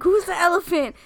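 high, loud startled call. Retold or imitated. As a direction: yell it like you heard a crash. Imitating startled housemates.

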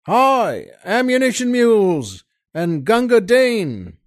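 high, loud battlefield call; front-line shout. Acted. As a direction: bellow down the line for ammo.

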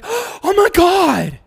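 high, slow drawn-out gasp of shock; mock shocked exclaim, high and drawn out; gasped exclamation. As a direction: overdramatic gasp then oh my God.

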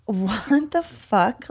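drawn-out, mildly stunned disbelief; retold disbelief. Dancer retells reacting to perfect-bodies remark, mildly stunned disbelief. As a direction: let the retold shock land slowly.

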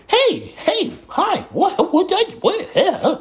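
very high, thin mocking voice; squeaky voice. Mocking the high voice game characters get, very high. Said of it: "Not the".

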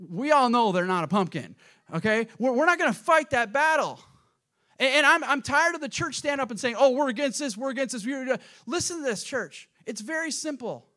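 loud, high, impassioned preaching; fired-up pulpit delivery; full-force preaching. Real, not acted. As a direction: raise the voice and exhort the church.

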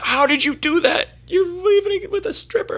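put-on shocked drunk buddy; amazed. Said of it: "He's like".

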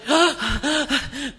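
mock exasperated gasp. Reacting to the tedious list.

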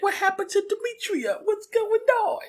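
very high put-on little voice; Alpha Six. Alpha asking after Demetria, little voice. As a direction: squeak the questions out, way up high. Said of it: "hooked him up with a little voice".

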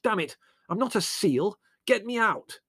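high, exasperated yell; indignant high-pitched demand. A narrator's reading. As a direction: yell for rescue, fed up. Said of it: "Larry yelled".

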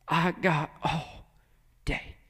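very soft, slow, lingering words; tender patience. Acted. God to a broken son, soft slow patience. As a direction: let it fall softly, with endless patience.

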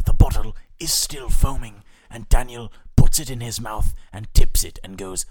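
lightly done nature documentary narrator; hushed, loud mock nature commentary; David Attenborough. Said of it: "a quick David Attenborough rundown".

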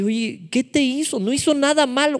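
high-pitched acted protest; Jonatán insisting. Preacher acts Jonatán defending his friend, insistent high protest. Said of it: "Jonatán todavía le insiste".

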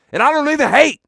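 high, loud exclamation; emphatic protest. Acted. Host insisting he is no hater, loud protest. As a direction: burst out in self-defense. Comic protest during the call-out.

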